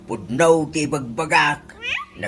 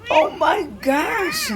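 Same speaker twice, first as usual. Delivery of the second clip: very high, slow, gushing delight; thrilled squeal; slower than usual. Acted. Father squeals with delight at his daughter's request. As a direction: squeal it out like a giddy fan.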